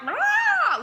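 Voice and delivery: high, loud imitated shriek; mock villain shriek. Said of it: "like, shrieking and screaming".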